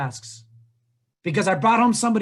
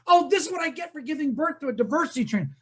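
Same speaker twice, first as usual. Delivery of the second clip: higher-pitched complaining mother; mock exasperated lament; 5 semitones higher than usual. Mother blaming her diversity-trainer son, mock exasperation.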